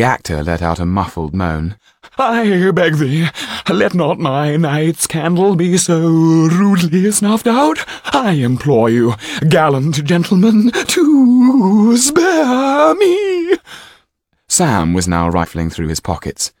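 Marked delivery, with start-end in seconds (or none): 2.2-13.6 slow, loud, high pleading rising to a long wailing peak; melodramatic pleading. Acted. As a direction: ham it up like a tragedian begging for his life. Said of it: "cried the gentleman".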